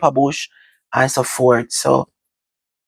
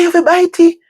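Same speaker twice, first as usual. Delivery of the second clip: high, loud mock command; insisting uncle; 5 dB louder than usual. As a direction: push the order through, half-joking. Uncle insisting child bite.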